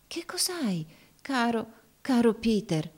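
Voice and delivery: high, wondering question; amazed. A narrator's reading. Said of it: "gli domandò meravigliata".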